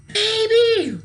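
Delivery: high, very loud scream of a name. A narrator's reading. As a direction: shriek her sister's name in outrage. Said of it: "screamed Gina".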